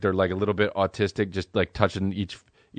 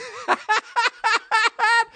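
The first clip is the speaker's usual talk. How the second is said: very high staccato mock giggle; rapid, squeaky comic cackle; imitated eating noise. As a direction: mimic silly munching in squeaky bursts. Mimics drunk friend eating brownie.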